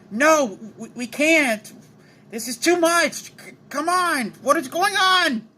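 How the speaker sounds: high-pitched, climbing distress; stammering acted panic, pitched high; panicked protest. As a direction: plead in a high, overwhelmed voice. Yugi struggling with the inner voice.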